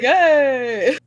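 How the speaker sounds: high, loud, drawn-out cheer; hammed-up loud exclamation; mock cheer. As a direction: play along with a big put-on cheer. Acted response to the man.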